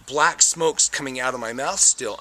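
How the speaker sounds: ordinary talk, nothing marked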